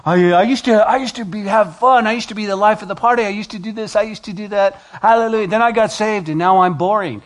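mocking a churchgoer who misses partying, high and whiny; mock whiny convert. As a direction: sulk like a bored ex-partier. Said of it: "it's not like".

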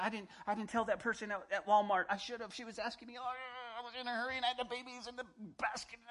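flustered believer. Retold or imitated. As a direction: babble excuses in a guilty rush.

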